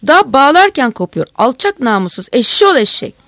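very loud, high cursing yell; furious shouting. A narrator's reading. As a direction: curse them at full volume. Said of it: "Öyle kızgınlıkla bağırıyordu ki".

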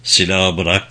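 plain reading voice

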